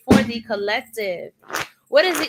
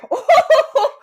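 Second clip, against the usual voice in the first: high, loud amused laugh; burst of laughter; 13 semitones higher, 9 dB louder than usual. Real, not acted. Reader laughing aloud at what the cards show.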